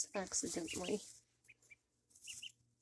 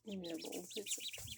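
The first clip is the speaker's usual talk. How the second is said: soothing; 3 semitones lower, 11 dB quieter than usual. Real, not acted. Calming the duckling.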